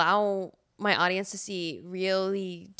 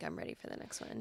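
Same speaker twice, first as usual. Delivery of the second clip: fast whispered inner voice; whispered thought; 3 semitones lower, 15 dB quieter, faster than usual. Retold or imitated. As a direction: breathe it out as a thought. Quoting her own thought.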